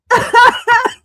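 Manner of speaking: sudden loud high laugh; real laughter; high burst of laughter. Real, not acted.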